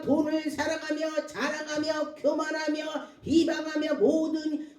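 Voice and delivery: high, driving sermon voice; insistent preacher's cadence; preaching. Real, not acted. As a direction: proclaim it to the congregation. Preaches, recites scripture list.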